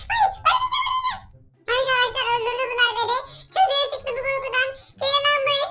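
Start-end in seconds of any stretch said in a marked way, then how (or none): 0.1-1.2 high, shrieking panic; Turkish: yüksek sesle panik çığlığı; panicked squeal. Pretend. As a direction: beg not to die, squealing. Zombies closing in on him.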